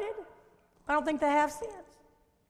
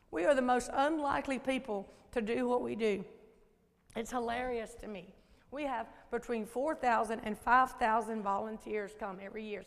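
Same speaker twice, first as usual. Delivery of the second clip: ordinary talk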